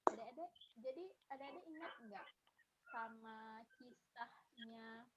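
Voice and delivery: far from the mic, barely audible; speaking away from the microphone; faint distant audio. Real, not acted. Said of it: "yang tadi kecil suara Kakak".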